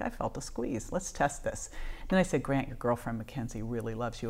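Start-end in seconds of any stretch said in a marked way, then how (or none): 0.0-1.7 much softer, held under the breath; hushed inner voice. Retold or imitated. Quietly thinking at coma bedside. 2.4-4.3 much softer and lower, tender; soft bedside words. Retold or imitated. Speaking softly to son in coma.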